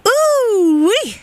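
high sliding ooh-wee; whooping as the mic comes on; excited whoop. Real, not acted. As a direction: let out a long, high, happy whoop. Host whoops back on air.

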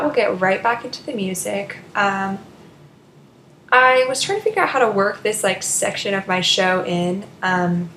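normal talking voice

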